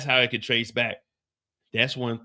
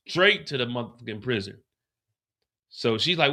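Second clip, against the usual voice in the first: normal talking voice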